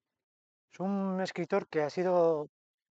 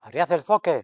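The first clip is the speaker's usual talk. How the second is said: a touch of a louder shipboard command; captain's order; 3 semitones higher, 9 dB louder than usual. Acted. Captain orders his crew in a storm, mildly shouting. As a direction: give it like a captain's firm order. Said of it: "ordena el capitán".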